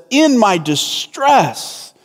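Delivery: "distress" drawn out with weight; stress on distress. Shown as a demonstration. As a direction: dwell on the word "distress". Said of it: "Jonah's distress. It gets the emphasis".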